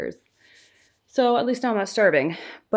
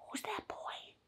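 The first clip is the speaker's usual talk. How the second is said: high breathless whisper, amazed; gasping whisper; 12 semitones higher, 17 dB quieter than usual. Real, not acted. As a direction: gasp then whisper it, full of wonder. Shows baby his reflection.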